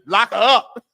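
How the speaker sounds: loud joking laugh through it; mock-serious quip; laughing joke. Real, not acted. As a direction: toss it off with a laugh.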